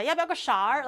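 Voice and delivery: 勺儿 with a curled erhua er, Beijing-style; light Beijing accent on a casual question. Retold or imitated. Streamer mimicking her parents on the phone, Beijing accent. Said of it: "the er sounds".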